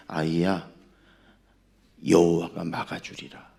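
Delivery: low, measured divine voice; voice of God. Acted. God speaks within wife's heart, low solemn voice. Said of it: "하나님이 마음속에".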